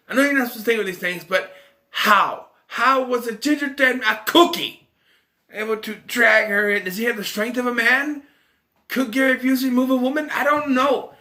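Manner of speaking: high, loud, exasperated disbelief; worked-up, baffled outburst; incredulous rant. Real, not acted. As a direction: demand an explanation for sheer nonsense. Ranting at an absurd plot point.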